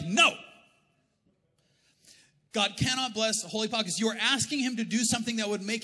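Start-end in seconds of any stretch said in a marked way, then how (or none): 0.0-0.4 loud, high, firm no; acted booming refusal from God; emphatic refusal. God answering a silly prayer, emphatically refusing.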